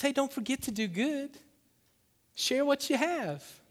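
high, light, drawn-out appeal; God's simple request. Acted. God reminding people to share, simply and lightly. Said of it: "God says".

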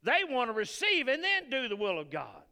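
loud, high preaching burst. Real, not acted. Preacher belting to the congregation, loud. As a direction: belt it to the congregation.

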